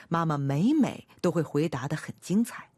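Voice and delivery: plain reading voice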